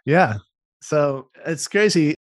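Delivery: ordinary talk, nothing marked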